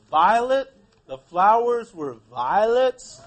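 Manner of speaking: leading teacher question; hinting emphasis. Shown as a demonstration. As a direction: lean on violets to nudge the class.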